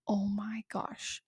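a hushed, amazed aside; awed whisper. Real, not acted. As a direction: breathe it out as a soft, slow whisper. Awed aside about embracing imperfection.

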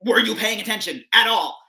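rhythmic poetry reading voice; spoken word poem. Acted. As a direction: deliver it like a slam poem.

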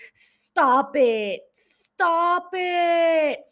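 hammed-up gushing denial; mock embarrassed squeal; flattered protest. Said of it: "Emma Chamberlain was like".

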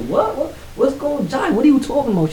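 very high, incredulous questioning; acted stunned confusion; retold astonishment. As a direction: blurt it out in high-pitched disbelief. Hearing startling news from sister.

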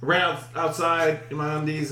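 usual speaking voice

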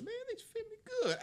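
very high, playful put-on voice; mock self-admiring quote. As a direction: squeal it in pretend delight at the clothes. Voices character trying on stolen clothes.

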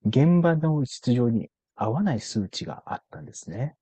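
slow, drawn-out careful delivery; very slow speech. Shown as a demonstration. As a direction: speak each phrase deliberately slowly. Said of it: "ゆっくり話しながら終わりますか". (ゆっくり話す)

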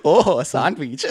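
slow, stretched mock-accented echo; hacky Hassan. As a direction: coworker catchphrase in a put-on accent. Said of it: "kind of a hacky Hassan".

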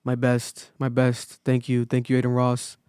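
low, subdued robotic monotone; robotic, socially awkward. Retold or imitated. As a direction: imitate a stiff, awkward guest mumbling thanks. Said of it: "Like, very robotic, like, almost, like, socially awkward".

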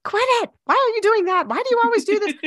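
put-on a stressed mom losing her patience at the kid; frustrated parent snapping. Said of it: "and from saying".

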